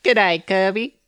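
a hint of an Aussie greeting, called out; playful Australian hello; Australian accent. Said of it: "He's Australian".

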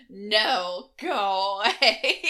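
loud slow drawn-out no; drawn-out moan. Retold or imitated. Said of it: "And she says".

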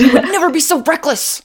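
high-pitched, hammed-up affront; mock indignation. Joking as insulted Mossad agents, mock indignant.

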